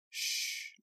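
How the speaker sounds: unvoiced soft shush; hushing. A narrator's reading. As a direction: hush her gently. Hushing her.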